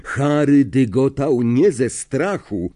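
ordinary delivery, nothing marked

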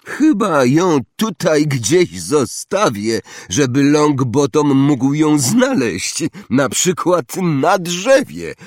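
high, slow, drawn-out taunt; Malfoy sneering. A narrator's reading. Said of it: "uśmiechnął się drwiąco".

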